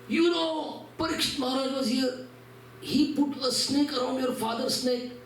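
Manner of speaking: put-on high, hurried children's voices; excited kids. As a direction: blurt it out like eager boys. Kids blurting out shocking news.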